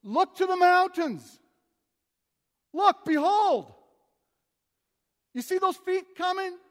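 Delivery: high, loud booming proclamation; voicing God. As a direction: call out like God announcing from on high. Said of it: "God saying".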